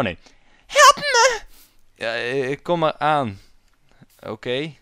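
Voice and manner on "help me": very high, loud squeal for help; falsetto princess. Acted. Princess calling for rescue, shrill falsetto. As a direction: shriek it like a hammed-up damsel.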